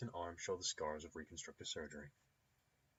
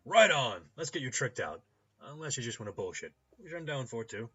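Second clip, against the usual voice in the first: loud, raised, hearty voice; boisterous clinic owner; 6 semitones higher, 13 dB louder than usual. Acted. Rigging doctor offering to trick out a customer, boisterous. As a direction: burst out heartily, then chat. Ambrose agrees to serve customer.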